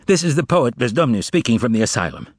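ordinary delivery

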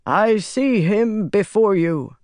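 high, slow declaiming; reciting prophecy. A narrator's reading. Pytar quotes the prophecy to Rand, solemn recitation. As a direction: intone it like an old prophecy. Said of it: "Pytar quoted".

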